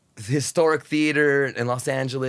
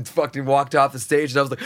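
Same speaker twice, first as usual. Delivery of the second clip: ordinary talk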